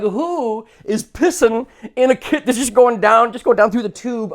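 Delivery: high, loud, slow disbelieving ask; reacting to a Notre Dame urinal ad, high and loud; mock outrage. Own quote retold.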